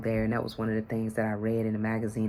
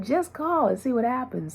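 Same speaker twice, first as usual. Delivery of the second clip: eager urging; 12 semitones higher than usual. Retold or imitated. Husband urging her to call.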